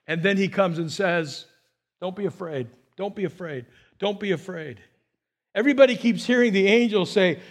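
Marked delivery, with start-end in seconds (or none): 2.0-4.8 gentle reassurance. Acted. Angel repeated soft comfort.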